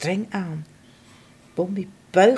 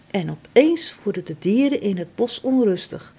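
ordinary delivery

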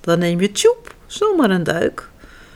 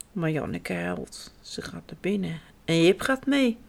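much softer, whispered telling; hushed narration; 4 semitones lower, 9 dB quieter than usual. A narrator's reading. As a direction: drop to a hush for the sad part. Sad moment, told quietly. (zacht fluisterend vertellen)

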